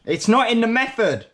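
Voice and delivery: high, loud frustrated cry with NOT punched; exasperated outburst. Real, not acted. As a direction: throw your hands up at the recipe.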